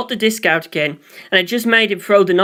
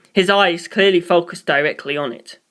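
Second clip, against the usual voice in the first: ordinary delivery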